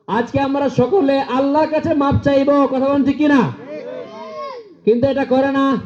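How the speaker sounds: high, ringing preacher's cry; fiery preaching. Acted. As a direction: preach it out to the crowd. Preaching at full force.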